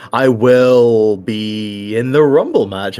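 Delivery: mock grand declaration. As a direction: stretch it out like a big reveal. Mock grand declaration, undercut right after.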